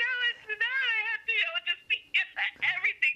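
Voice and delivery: high, mock-distraught argument; imitating your own frazzled pleading; retold upset protest.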